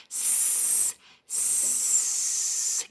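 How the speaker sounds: long unvoiced S hiss, like a snake; drawn-out s like a snake. Shown as a demonstration. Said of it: "Can you make that sound with me?".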